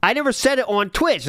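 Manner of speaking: a light mock whine of his excuse; mocking imitation. Streamer mimics Philip denying it, lightly mocking. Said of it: "he would say that".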